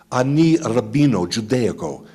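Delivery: hammed-up mock Italian accent; fake Italian. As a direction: put on a bad fake Italian. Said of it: "I speak fake Italian".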